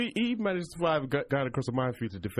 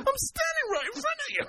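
high wailing mock upset; hammed-up wounded wail; mock accused crying.

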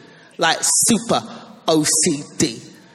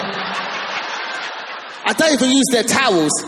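slow disgust; 2 semitones higher, much slower than usual. Real, not acted.

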